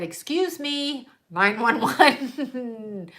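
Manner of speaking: slow, drawn-out mock caller; mock emergency caller. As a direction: put on a slow, incredulous bystander's voice. Acted bystander reporting to emergency line.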